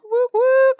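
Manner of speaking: high, loud whoops of joy; excited. Real, not acted. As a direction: cheer it out. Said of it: "So excited".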